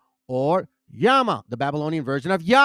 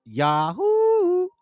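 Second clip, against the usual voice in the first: drawn-out name; 13 semitones higher than usual. Shown as a demonstration. Preacher hammers home the name, Yahoo high and sustained.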